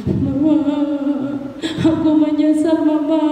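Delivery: mild sung sorrow; tearful apology. Acted. Lost cub apologizing to his mother, mildly tearful. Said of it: "sambil menangis".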